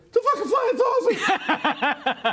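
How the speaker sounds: high, slow, laughing outburst of disbelief; excited shout. Retold or imitated. Friend in the car yells at the passing UFO, laughing shout. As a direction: yell it out half laughing, wide-eyed.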